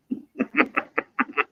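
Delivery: high burst of laughter; laughing. Real, not acted. Reading chat message.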